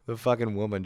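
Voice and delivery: normal talking voice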